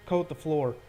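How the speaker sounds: ordinary delivery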